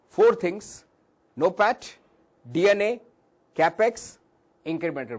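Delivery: loud, high-pitched emphatic list; projected lecturer's voice, punching each term; as loud as possible. Real, not acted. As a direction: call out each item forcefully, one by one. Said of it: "I'll be as loud as possible".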